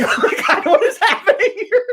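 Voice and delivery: high, squeaky laughing gasp of disbelief. Retold or imitated. Watching Doctor Phil on local TV, high laughing disbelief. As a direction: laugh it out, shocked at the TV. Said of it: "And it's like".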